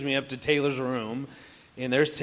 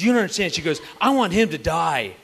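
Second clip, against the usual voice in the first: mock angry daughter; 2 semitones higher, 8 dB louder than usual. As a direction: play the furious little girl stamping her foot. Daughter's angry outburst retold.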